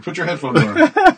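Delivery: high, slow mock Ben voice; squeaky imitation of Ben. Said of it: "Ben's like".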